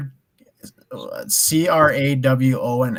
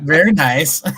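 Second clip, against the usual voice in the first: loud catchphrase in a put-on foreign accent; exaggerated foreign-accent catchphrase; Borat; 3 semitones higher, 6 dB louder than usual. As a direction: belt out a comic impression with a laugh. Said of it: "I trust Borat more than anyone".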